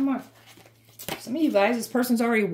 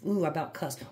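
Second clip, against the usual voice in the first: sheepish aside; 5 semitones lower, 7 dB quieter than usual. Real, not acted. Catches herself nearly swearing.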